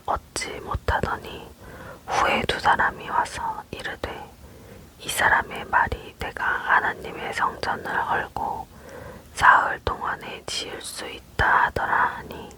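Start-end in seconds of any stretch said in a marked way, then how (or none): none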